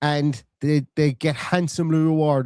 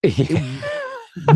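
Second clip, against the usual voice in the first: ordinary talk, nothing marked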